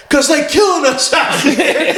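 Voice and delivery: high, drawn-out, hammed-up cry; mock panicked wail. Fleeing driver explaining to boss, mock high drawn-out wail.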